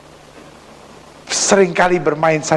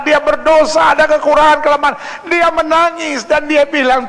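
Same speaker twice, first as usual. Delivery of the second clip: impassioned preaching; 11 semitones higher, 3 dB louder than usual. Acted. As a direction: stretch each phrase out in a high pulpit voice. Preacher at full force. (berkhotbah berapi-api dengan nada tinggi)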